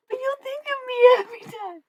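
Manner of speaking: very high, loud mock-whiny child; imitated pleading kid. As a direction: mimic a tearful kid's plea, way up high. Mimicking the kid's plea to keep it.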